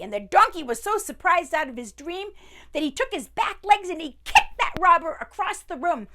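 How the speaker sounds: breathless excited narration. A narrator's reading. Chaotic fight scene builds up.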